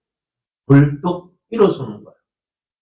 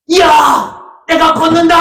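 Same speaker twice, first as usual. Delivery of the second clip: high, loud cry of joy; overjoyed shout; 14 semitones higher, 6 dB louder, slower than usual. Retold or imitated. Healed beggar shouts to the temple, crying out for joy. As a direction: shout it like a man who can walk for the first time. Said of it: "하면서".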